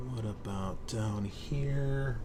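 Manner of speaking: ordinary talk, nothing marked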